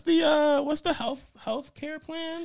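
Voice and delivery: slow, high, stretched question; drawn-out question. Pretend. Asking Bowser, drawn out.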